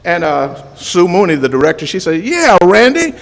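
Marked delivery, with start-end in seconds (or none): 2.2-3.1 higher, louder, quick and laughing; mock teasing retort. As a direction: tease him back while cracking up. Director's retold mocking comeback.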